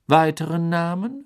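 kühl und langsam; kalt. Acted. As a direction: eisig fragen. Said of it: "fragte Crouch kalt". (Crouch fragt Karkaroff kalt nach mehr)

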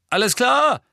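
high, loud captain's call. A narrator's reading. Angelina restarts practice, calling out. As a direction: call the team to attention. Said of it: "rief Angelina".

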